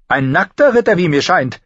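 high, loud sneering jibe; mock the old man sweetly; Littlefinger mocking. A narrator's reading. Littlefinger mocking the disrobed knight, high and loud. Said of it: "spottete Littlefinger".

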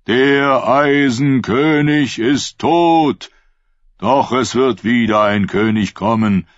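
preaching prophet. Acted. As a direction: proclaim it like a fervent prophet. Prophet preaching to his flock. (Prophet predigt laut und langsam zu seiner Schule)

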